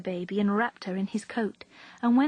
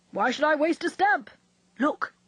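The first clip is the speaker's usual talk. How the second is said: gruff uncle; 6 semitones higher, 3 dB louder than usual. A narrator's reading. Gruff uncle answers niece.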